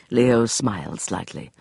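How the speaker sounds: ordinary delivery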